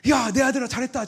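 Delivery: high, loud, beaming call of a proud father; God's proud praise. Retold or imitated. Imagined God cheering his son, high and loud. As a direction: cry out the praise as if heaven is cheering.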